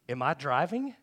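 loud mock-baffled question; loud retold question. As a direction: call it out loudly, rising at the end. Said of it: "she says it very loudly".

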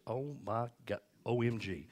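soft, awed disbelief; quietly stunned, as if marvelling; hushed amazement. Acted. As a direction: play it softly astonished. Acting out Jesus amazed at faith.

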